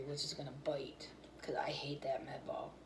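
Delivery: soft unvoiced whisper, muttered to herself; whispered grumble. Real, not acted. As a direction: whisper the complaint like a secret aside. Grumbling aside about hated med ball.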